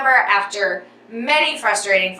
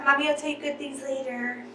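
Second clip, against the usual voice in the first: much softer, hushed tender promise; 4 semitones higher, 10 dB quieter than usual. Real, not acted. Mother promising her unborn baby, softly hushed. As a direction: murmur it gently to the belly.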